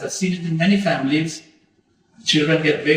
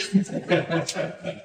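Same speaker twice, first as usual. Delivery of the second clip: breathy chuckle; 5 dB quieter than usual. Real, not acted. Laughs at his own joke.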